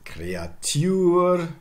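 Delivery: "Kreatur" as kray-a-TYOOR; high "Kreatur", y-glide at the end; Middle English pronunciation. Shown as a demonstration. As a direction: stretch the last syllable of "Kreatur". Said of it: "more examples".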